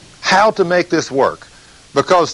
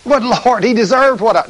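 higher pleading objection; preacher argues with the Lord, whining higher; whiny protest; 9 semitones higher than usual. Retold or imitated. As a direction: protest like a sulky child. Arguing back with the Lord.

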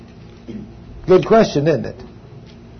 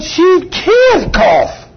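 incredulous doctor; 13 semitones higher, 3 dB louder, much slower than usual. Retold or imitated. Doctor disbelieving the cough.

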